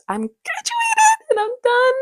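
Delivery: very high, loud, mock-tearful squeal; squealed celebration. Student declaring she is finished with school, squealed celebration. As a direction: squeal it out, almost crying with joy.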